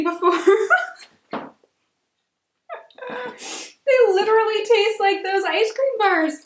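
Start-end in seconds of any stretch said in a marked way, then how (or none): 3.9-6.5 slow, high, near tears; choked up with nostalgia; emotional. Real, not acted. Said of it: "so emotionally attached".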